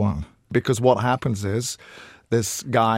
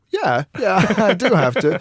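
laughing retort; 9 semitones higher, 5 dB louder than usual. Acted. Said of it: "gleeful retort to critics".

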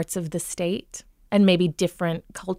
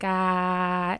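long, wide, flat Minnesota "ah"; Minnesota hesitation sound. Retold or imitated. Coach imitating a client's hesitation sound, wide flat Minnesota. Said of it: "a great Minnesota hesitation sound".

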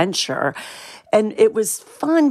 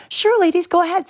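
much higher, chirpy gate agent voice; breezy go-ahead from an obliging agent; cheery agent; 13 semitones higher than usual. Retold or imitated. Gate agent letting the girls onto the plane, high and breezy.

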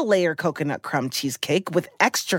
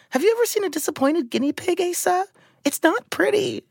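mock distress; 12 semitones higher than usual. Retelling disappointed pet, rising voice.

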